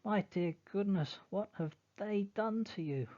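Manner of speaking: high, loud alarmed cry; father's shocked scream. Acted. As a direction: cry out at the injury. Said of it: "then screams".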